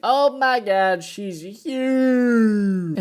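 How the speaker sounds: high, stretched-out awed exclamation; mock amazement. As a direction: gasp it out high like an astonished onlooker. Acted amazement at a huge woman.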